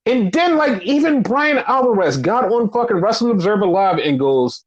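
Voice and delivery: ordinary talk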